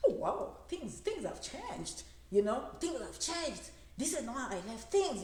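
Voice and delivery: lightly put-on American accent, amazed; a hint of an American accent, wowed at home; mock American accent. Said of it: "you're talking in American accent".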